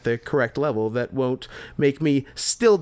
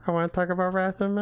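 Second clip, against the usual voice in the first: put-on fast whiny baby voice; 4 semitones higher, 5 dB quieter, much faster than usual. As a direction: babble it like a toddler. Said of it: "talking like a fucking baby".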